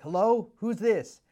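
high, loud, slow groggy phone pickup; startled Ken. Retold or imitated. Ken woken by a stranger's late call, startled high answer.

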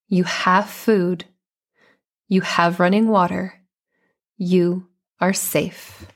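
calm, measured delivery with long pauses; guided meditation. Real, not acted. Coach leading moms through a guided meditation, calm and unhurried. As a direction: guide them gently inward, phrase by phrase.

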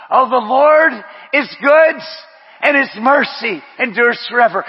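loud, high proclamation; fiery preaching. Acted. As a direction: shout it to the congregation. Preaching at full force.

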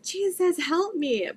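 mild mock yell, pitched high; retold yell. Retelling her plea as elders prayed, a mild yell. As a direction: cry out in a high voice without full volume. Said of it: "Yell for Jesus".

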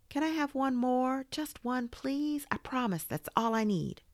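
high-pitched wheedling; eager, high little plea; pleading. Acted. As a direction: beg like a child at bedtime. Toy begs another story.

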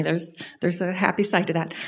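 ordinary delivery

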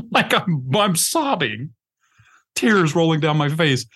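a real laugh breaks through the words; laughing while talking. Real, not acted. To his friends, recalling the retreat, laughing. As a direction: chuckle through it as you admit the sobbing.

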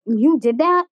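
confused surprise. Retold or imitated. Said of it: "she was confused".

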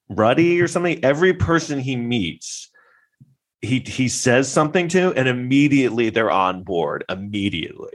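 ordinary talk, nothing marked

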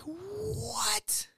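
very high, hushed drawn-out "what"; squeaky soft gasp of disbelief; comic stunned falsetto; shocked. Retold or imitated. Shock at news of divorce.

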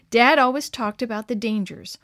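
ordinary delivery

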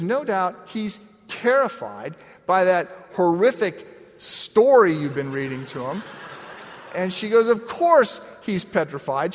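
hammed-up scolding wife; wife's rebuke. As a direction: imitate an exasperated wife. Wife scolding in retold story.

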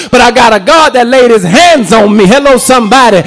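usual speaking voice

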